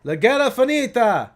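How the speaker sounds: loud, high mock Italian accent; old Italian man. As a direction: call out as a caricature old Italian. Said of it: "the old man with the espresso".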